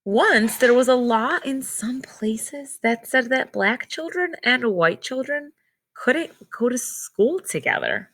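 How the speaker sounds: clear, measured read-aloud storybook voice; reading to children. Acted. Teacher reads picture book aloud.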